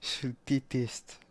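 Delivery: very slow, stretched words; drawn-out loathing. Acted. Player repeating their hatred of someone, slowly drawn out. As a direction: drag each syllable out.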